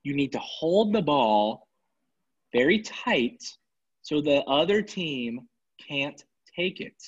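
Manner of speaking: slow, deliberately spelled-out words; patronizing slow explanation. Shown as a demonstration. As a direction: talk down to him slowly, like to a child. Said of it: "I'm gonna say it very slow so you'll understand".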